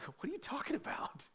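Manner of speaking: breathy laughing whisper of disbelief; sons-in-law laughing off the warning, whispered. Retold or imitated. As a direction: scoff it under a laugh. Sons-in-law mocking Lot.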